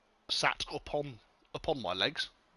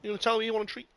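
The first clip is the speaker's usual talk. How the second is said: higher sing-song voice for the dog; pet talk; 6 semitones higher, 4 dB quieter, much faster than usual. Real, not acted. Owner offering his dog a treat, higher pet voice.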